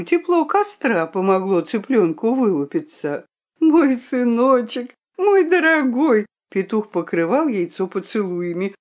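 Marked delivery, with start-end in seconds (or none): 3.6-6.3 put-on dote on the baby, near tears; tearful tenderness. A narrator's reading. The rooster kisses his hatching egg, tearfully tender. Father overjoyed at hatching son.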